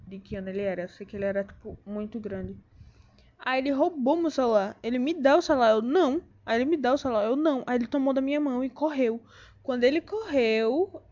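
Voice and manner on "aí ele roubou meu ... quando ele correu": agitated retelling. Real, not acted. Retelling phone theft, agitated.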